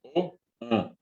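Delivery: first O open and oral, second o pushed through the nose; teacher contrasting oral and nasal O; oral then nasal O. Shown as a demonstration. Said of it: "El caso de la O y la O".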